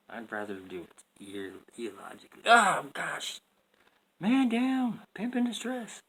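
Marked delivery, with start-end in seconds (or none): 2.4-5.0 high, loud startled yelp; startled outcry. Real, not acted. Blinded by bright light. 5.1-6.0 high, loud hammed-up cry; mock distress call. Loud first take.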